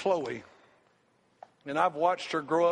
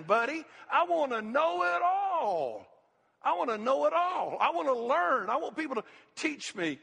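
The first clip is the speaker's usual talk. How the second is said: high-pitched, driving sermon delivery; impassioned preaching; 8 semitones higher than usual. Real, not acted. Preacher urging the church to want to learn, impassioned preaching. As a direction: preach it hard, stretching the big cries.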